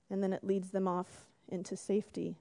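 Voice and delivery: ordinary talk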